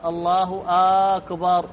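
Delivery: long "aaa" opening أكبر in الله أكبر; stretched hamza of akbar. Shown as a demonstration. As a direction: say "Allahu aaakbar", drawing out the first vowel of أكبر. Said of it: "يمد الهمزة". (مد همزة "أكبر")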